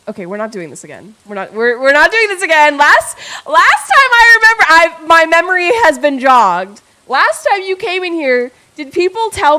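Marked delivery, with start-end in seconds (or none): 1.5-2.8 mock scolding. 2.8-9.6 high, loud accusing rant; playful accusation of a birthday liar; mock outrage. Accusing a chatter of lying.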